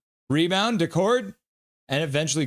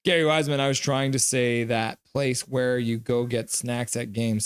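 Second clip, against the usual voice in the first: normal talking voice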